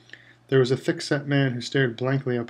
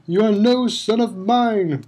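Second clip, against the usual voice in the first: higher, louder, slow rage; furious bellow; 8 semitones higher, 6 dB louder, slower than usual. A narrator's reading. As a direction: disown him with a bellow. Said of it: "bellowed Mister Crouch".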